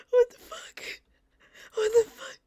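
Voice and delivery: high, loud frightened cry; panicked cry. Real, not acted. Startled in the horror game, panicked cry.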